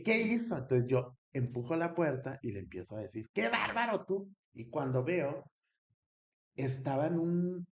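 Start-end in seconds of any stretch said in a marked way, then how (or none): 0.0-0.6 high, loud startled yell; loud retold outburst at the cousin. 3.3-4.3 high, loud scolding cry; loud mock scolding at the cousin; retold scolding shout. Scolding cousin she thinks joking.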